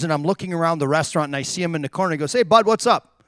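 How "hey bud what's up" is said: louder, higher, breezy hail to a buddy; retold breezy greeting. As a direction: call out a casual hello. Retold casual greeting from teammate.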